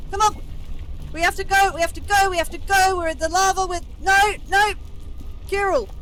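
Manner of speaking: very high, very loud desperate yells; panicked shouting. Real, not acted. Player yelling to teammate from lava, panicked shouting. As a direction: scream for help as everything goes wrong.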